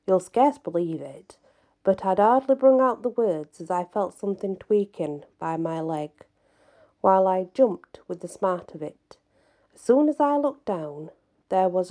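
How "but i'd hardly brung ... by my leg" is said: usual speaking voice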